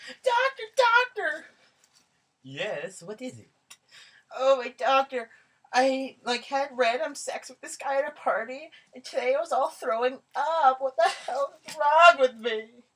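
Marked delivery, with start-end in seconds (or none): 0.1-1.5 high shrill call. Acted. Karen calling for doctor. 4.4-12.8 put-on high ditzy valley girl; goofy muffled sock puppet. Karen tells doctor.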